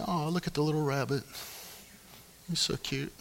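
soft, quick cooing at a cute rabbit. Retold or imitated. Couple at the pond cooing at a rabbit, soft and quick. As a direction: coo at the little bunny.

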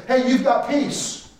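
high, hammed-up cheerleader pep; mock hype. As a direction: fake upbeat pump-up line. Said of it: "pump people up and say".

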